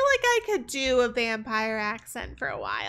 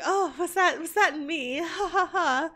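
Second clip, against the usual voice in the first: higher, flustered cover-up with a laugh; vampire streamer slip; 5 semitones higher than usual. Acted. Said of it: "slip into the accent every now and then".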